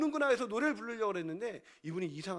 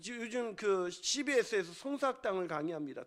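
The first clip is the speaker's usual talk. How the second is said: ordinary talk, nothing marked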